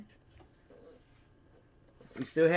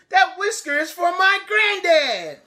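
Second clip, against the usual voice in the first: raised high voice; high calling out; 12 semitones higher, 6 dB louder, slower than usual. Acted. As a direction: call it after the parrot. Beatrice still calling to the parrot.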